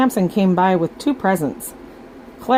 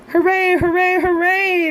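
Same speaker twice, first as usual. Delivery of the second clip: screaming cheers; 12 semitones higher than usual. Acted. Children cheer at the broken piñata, shouting hooray. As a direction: cheer as the candy falls. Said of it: "they screamed".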